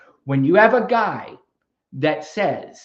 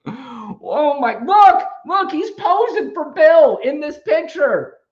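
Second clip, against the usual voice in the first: much higher, louder, laughing exclamation; excited shout; 10 semitones higher, 7 dB louder, slower than usual. Real, not acted. Host shouting at a picture he is showing the caller, excited. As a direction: shout with glee at the photo.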